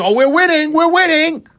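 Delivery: mock the people who claim the war is going well; mocking quote. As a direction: parody someone's empty boasting. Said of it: "who comes to you and tells you".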